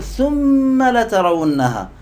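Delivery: verse quotation. Shown as a demonstration. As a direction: draw out ثم لترونها in solemn quotation. Quotes the Quranic verse. (تلاوة ممدودة مرتفعة الطبقة)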